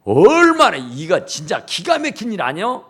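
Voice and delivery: ordinary delivery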